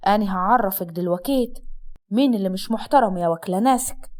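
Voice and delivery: low-pitched Upper Egyptian husband; scornful husband. Acted. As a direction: drop low and taunt the wife. Voices the threatening husband. (زوج صعيدي ساخر بصوت واطي)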